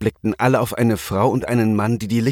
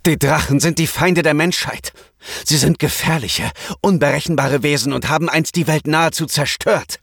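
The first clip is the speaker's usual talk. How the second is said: blustering; 7 semitones higher than usual. A narrator's reading. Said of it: "polterte Keno".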